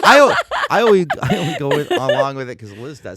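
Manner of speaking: loud, laughing protest. Real, not acted. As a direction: laugh through the excuse. Laughing defence of himself.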